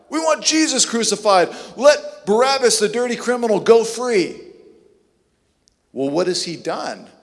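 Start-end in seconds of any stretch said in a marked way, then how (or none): 0.1-4.4 mob shouting. Retold or imitated. Crowd demanding crucifixion.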